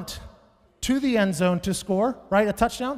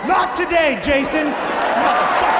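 triumphant yell; 8 semitones higher, 7 dB louder than usual. Retold or imitated. Comic reliving his tackle on stage, yelling in triumph. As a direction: roar it like you just made the tackle.

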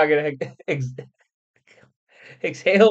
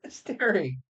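talking through hiccups; hiccuping after laughing; 2 semitones higher, 6 dB quieter, slower than usual. Real, not acted. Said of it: "Oh, I got the hiccups now".

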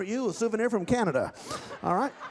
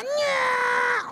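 puppet lady voice; 6 dB louder than usual. Acted. Ventriloquist voices volunteer lady puppet.

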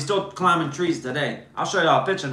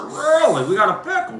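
mock surprise; 5 semitones higher than usual. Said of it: "I said".

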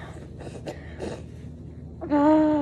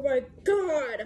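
crying softly; 3 semitones lower, 7 dB quieter than usual. Real, not acted. Pain, crying.

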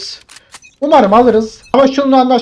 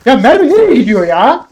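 high, loud exasperated yell; real shouting; 5 semitones higher, 6 dB louder than usual. Real, not acted. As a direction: shout it in disbelief, swooping up on "nereye". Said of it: "Bağırma". (isyan edercesine bağırarak)

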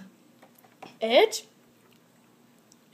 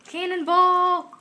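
cannonball yell; 7 semitones higher, 3 dB louder, slower than usual. Acted. Jumping into the pool.

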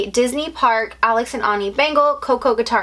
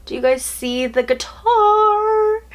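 high, slow thrilled question; excited squeal; 11 semitones higher, slower than usual. Real, not acted. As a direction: hold up the guitar, voice soaring. Showing the guitar.

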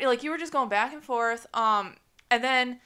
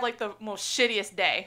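usual speaking voice

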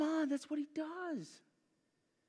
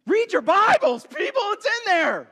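loud and much higher, calling out to the room; emphatic exhortation; 9 semitones higher, 7 dB louder than usual. Real, not acted. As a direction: rouse the whole church with it. Preacher exhorting the congregation.

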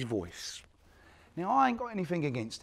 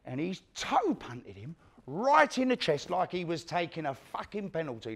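usual speaking voice

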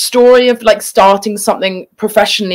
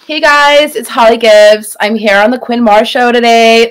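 put-on Valley Girl accent; 3 semitones higher, 4 dB louder than usual. Shown as a demonstration. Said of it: "the Valley Girl accent".

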